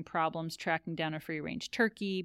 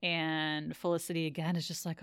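usual speaking voice

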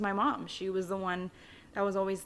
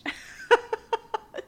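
high bright laugh breaking out; burst of laughter; 4 dB louder than usual. Real, not acted. Narrator laughing at her childhood memory, high loud laugh.

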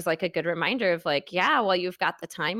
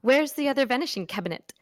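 mock British accent, fast and lightly raised; imitating the film actors' British take on "vanishing cabinet"; light British film-voice parody; British accent impression; 3 semitones higher, faster than usual. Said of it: "The way they say it is".

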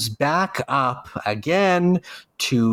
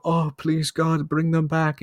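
higher-pitched imitation of a begging parent; mock pleading; 4 semitones higher than usual. Bereaved parent begs God for kids back, mock pleading. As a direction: beg God, lightly acted.

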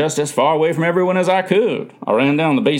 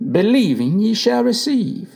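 slow, higher-pitched Bible reading; reading scripture aloud; 8 semitones higher, slower than usual. A narrator's reading. Mother reading a Bible verse at the deathbed, slow recital. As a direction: read the verse out slowly and deliberately. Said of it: "she read".

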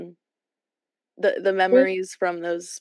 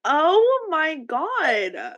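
shocked; 9 semitones higher, 3 dB louder, much slower than usual. Real, not acted. Shocked at the used pants.

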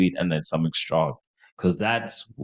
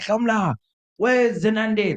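put-on high, drawn-out drunken calling; imitated drunk friend; slower than usual. Drunk friend asking after his crush, imitated high call. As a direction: plead for someone in a high, slow mock wail.

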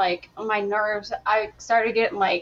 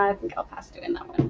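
whisper; 2 semitones lower, 7 dB quieter than usual. Retold or imitated. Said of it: "but I was like".

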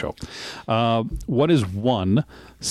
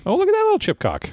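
excited squeal; faster than usual. Real, not acted. Amused squeal at the photo.

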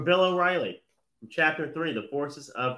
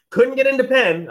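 sarcastic jab; 11 semitones higher, 10 dB louder than usual. Real, not acted. Sarcastic jab at New York kids.

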